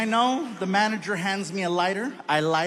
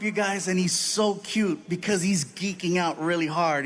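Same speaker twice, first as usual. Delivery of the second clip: normal talking voice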